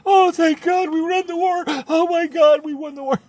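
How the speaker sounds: very high, loud shout of relief; overjoyed cry. Retold or imitated. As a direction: cry out as if the war is won. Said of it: "They were happy".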